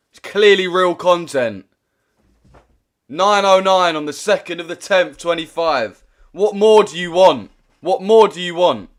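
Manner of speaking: loud, high, indignant demand; indignant protest. Real, not acted. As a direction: plead your case angrily. Arguing with TikTok moderation.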